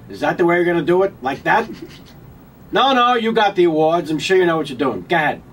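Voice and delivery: loud, needling Rickles jab; mock Rickles insult; Don Rickles impression. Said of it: "And Rickles says".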